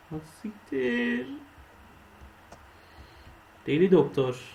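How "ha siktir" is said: a sudden high, slow Ha siktir; startled high curse. Real, not acted. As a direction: caught off guard, Ha siktir drawn out up high. Scared by crocodile. (yüksek sesli şaşkın küfür)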